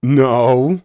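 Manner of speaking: sudden loud no; blurted. A narrator's reading. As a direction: blurt it out at once. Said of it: "she blurted out".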